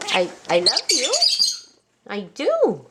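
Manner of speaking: ordinary talk, nothing marked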